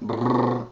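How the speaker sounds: R- trilled on its own; just the rolled R sound by itself; demonstrating the R alone; isolated rolled R. Shown as a demonstration. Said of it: "See the R?".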